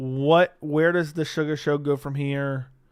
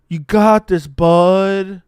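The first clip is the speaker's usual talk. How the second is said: loud rallying call; a cheer from ringside; louder encouragement; 3 semitones higher, 11 dB louder, much slower than usual. Real, not acted. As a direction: shout it to lift him up. Cheering on fighter.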